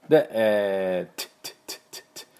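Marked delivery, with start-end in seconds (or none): 1.2-2.3 whispered t bursts repeated; t sound drill. Shown as a demonstration. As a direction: repeat a crisp voiceless t. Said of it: "Tの音を出して".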